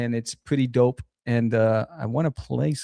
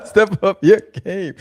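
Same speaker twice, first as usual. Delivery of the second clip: laughing; 6 semitones higher, 8 dB louder, slower than usual. Real, not acted. Echoes host, laughing.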